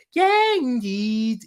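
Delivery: "indeed" drawn out in a Scouse accent, pitched high; hammed-up Liverpool Scouse "indeed". Shown as a demonstration. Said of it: "in the Scouse accent".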